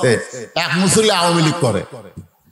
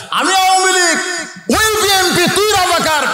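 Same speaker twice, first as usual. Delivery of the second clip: mock party leader; 11 semitones higher than usual. Preacher voices a party leader.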